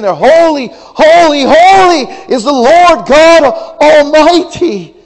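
loud, high, slow crying shout; seraphs crying out. Acted. Preacher voicing seraphim crying holy to one another, shouting. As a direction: cry it out like the seraphim.

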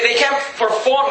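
normal talking voice

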